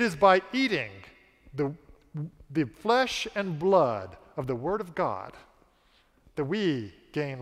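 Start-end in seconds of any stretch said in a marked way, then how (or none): none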